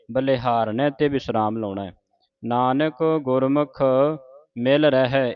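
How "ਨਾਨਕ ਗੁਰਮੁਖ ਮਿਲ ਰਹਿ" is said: slow recital resting on ਰਹਿ; pause after ਰਹਿ. Shown as a demonstration. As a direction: stop briefly after ਰਹਿ. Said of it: "ਇੱਥੇ ਵਿਸਰਾਮ ਹੈ".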